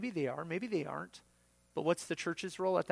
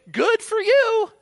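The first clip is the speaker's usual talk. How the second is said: very high, loud, drawn-out call; mock cheering; 20 dB louder, slower than usual. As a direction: sing out hammed-up approval. Mock approval shouted to lost hiker.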